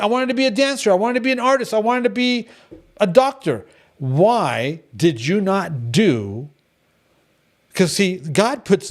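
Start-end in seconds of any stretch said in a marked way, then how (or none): 0.0-3.7 higher, sing-song string of excuses; lightly mocking voice; mock whiny excuses. Mocking excuses, pitch rising. 4.0-6.5 drawn-out, slow challenge; slow rhetorical why; slow pointed question. Real, not acted. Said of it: "slow pointed question".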